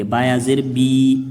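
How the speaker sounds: slow recitation, stretching the बी; teacher drawing out the long vowel; drawn out. Shown as a demonstration. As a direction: stretch बी for one alif's length. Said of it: "एक या मद्दा के बराबर खींच के पढ़ेंगे".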